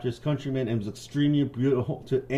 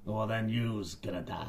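menacing pirate; 3 semitones lower, 8 dB quieter than usual. Acted. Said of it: "pirate threat".

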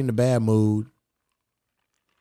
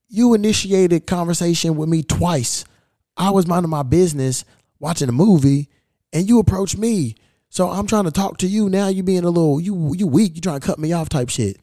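high-pitched, aggrieved protest; indignant confrontation; 6 semitones higher than usual. Retold or imitated. Traveler confronting the old man who approached him first, indignant. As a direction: act out calling someone out, pitch raised.

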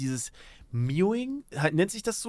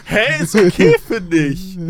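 laughing; 2 semitones higher, 8 dB louder than usual. Real, not acted. Laughing at friend's face.

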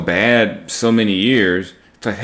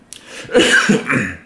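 breathy coughing; a cough still clearing the throat; choking cough; 7 semitones higher, 3 dB louder than usual. Real, not acted. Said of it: "mm, excuse me".

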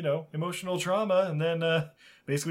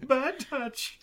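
high squealing cries for help; fluttering cries for help; 5 dB louder, slower than usual. Acted. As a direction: squeal and flap like a flustered squirrel. Squirrel fighting off lady squirrel.

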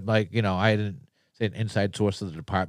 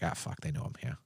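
hushed whisper of panic; 6 semitones lower, 14 dB quieter than usual. Retold or imitated. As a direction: whisper it, caught out. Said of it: "I was like".

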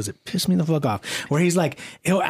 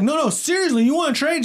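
much higher, hammed-up eager influencer voice; mock YouTuber voice; 11 semitones higher than usual. Mocking a YouTuber offering a stranger his car, high and hammed-up. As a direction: imitate a too-cheery YouTuber making an offer. Imitating Mister Beast insisting.

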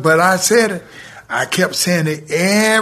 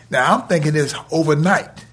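usual speaking voice

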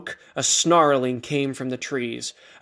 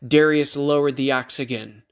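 ordinary delivery

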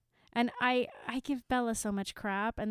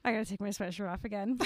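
fast flustered aside; flustered rush; much faster than usual. Real, not acted. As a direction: blurt it out hurriedly.

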